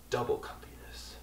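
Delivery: hushed, tempted whisper; voiceless conspiratorial whisper. Pretend. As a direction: breathe it like a guilty secret idea. Sly aside to himself.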